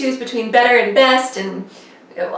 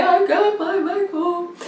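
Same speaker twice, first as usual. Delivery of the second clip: higher, slow tearful whimper; mock crying whimper; 6 semitones higher, slower than usual. Wife on the couch whimpering about the comb, mock crying. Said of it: "I'm sitting on the couch and I'm crying".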